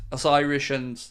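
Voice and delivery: ordinary talk, nothing marked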